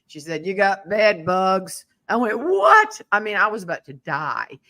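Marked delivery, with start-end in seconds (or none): 0.5-1.9 slow, tough Southern smoker voice; gravelly smoker. Retold or imitated. Renter breaks bad news to landlady, slow gravelly smoker voice. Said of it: "that gravelly smoker voice". 2.4-3.0 loud, high-pitched mock-terrified reply; shocked high yelp. Landlady hears of bed bugs, shocked high yelp. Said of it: "my voice goes, mm, an octave up".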